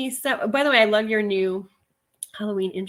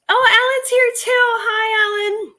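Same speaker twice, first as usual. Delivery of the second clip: much higher, louder, delighted; light up as a friend arrives; excited greeting; 13 semitones higher, 7 dB louder than usual. Real, not acted. Greeting Alan joining the stream, delighted and loud.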